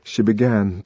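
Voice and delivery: ordinary delivery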